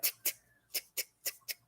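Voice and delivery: quiet, toneless mouth clicks in pairs; imitated ticking. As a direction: mimic a faulty blinker ticking away. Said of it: "he keeps getting this".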